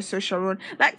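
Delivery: usual speaking voice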